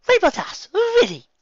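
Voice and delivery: very high, loud; indignant Rarity. Acted. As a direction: scold in disbelief.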